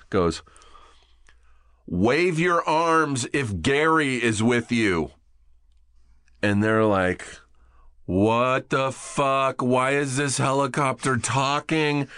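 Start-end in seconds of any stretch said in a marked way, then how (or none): 1.9-5.1 slow, high, stretched-out call over a loudspeaker; helicopter PA announcement. Retold or imitated. Helicopter crew hailing hikers on a mountaintop, slow PA call. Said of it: "someone on the P A on the helicopter". 8.0-12.1 high, very drawn-out, tripped-out bewilderment; mock freaked-out. Tripping friends reacting to a talking helicopter, mock freak-out. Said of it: "They were all fucking freaking out".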